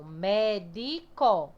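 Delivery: MÉDICO drawn out syllable by syllable; split into syllables. Shown as a demonstration. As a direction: say "Médico" very slowly, each syllable held apart. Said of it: "Quando eu separo a palavra médico".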